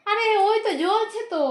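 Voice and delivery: high, loud exasperated insisting; exasperated high protest. Real, not acted.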